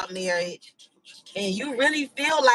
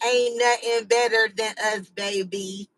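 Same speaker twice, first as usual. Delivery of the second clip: ordinary talk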